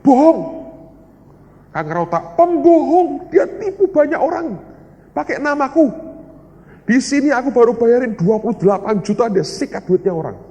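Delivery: high, loud outraged accusation; retold angry yell. Older sibling yelling that the caller is a swindler, high and loud. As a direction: shout the accusation, acting out the outrage. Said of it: "Dia teriak sama saya".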